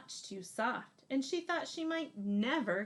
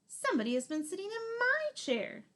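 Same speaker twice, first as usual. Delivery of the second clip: higher mama bear voice; a higher, motherly bear; 10 semitones higher, 3 dB louder than usual. Acted. Mama Bear finds her porridge eaten, higher voice. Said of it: "said Mama Bear".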